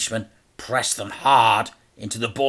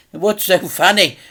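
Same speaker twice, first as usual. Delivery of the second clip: crime boss mimicking the boy, higher and louder; higher mocking echo; 9 semitones higher, 7 dB louder than usual. A narrator's reading. Mister Big mockingly repeats the boy's question. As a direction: throw the boy's words back at him.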